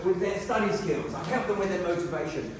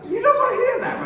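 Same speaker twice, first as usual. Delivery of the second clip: high laughing exclamation; 8 dB louder than usual. Real, not acted. Speaker laughs at the accountant's hindsight, high and laughing.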